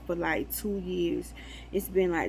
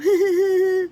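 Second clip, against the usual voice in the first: loud laugh; 10 semitones higher, 8 dB louder than usual. Real, not acted. Laughing at robot dog.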